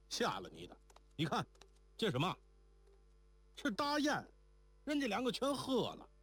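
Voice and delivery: urgent pointing-out, climbing to a high pitch; emphatic revelation. A narrator's reading. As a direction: build to a high, agitated disclosure. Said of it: "瞪起眼指着地下道". (情急地高声点明)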